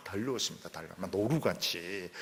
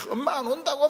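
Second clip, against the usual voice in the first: put-on much higher child's whine; whiny child; 5 dB louder than usual. Child on the phone whining that mom isn't coming. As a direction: whine like a kid waiting.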